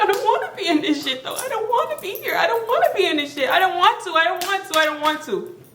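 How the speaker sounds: high, giggling protest; half-laughing, pleading not to be there; laughing whine. Retold or imitated. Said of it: "I'm like".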